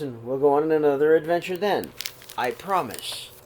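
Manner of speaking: calm, even read-aloud voice; steady storybook telling; story narration. A narrator's reading. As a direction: read the story gently to the kids. Reads picture book aloud.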